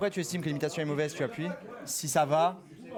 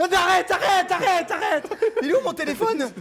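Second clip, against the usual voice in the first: panicked shouting; 11 semitones higher, 9 dB louder than usual. Real, not acted. Getting zapped, begging to stop.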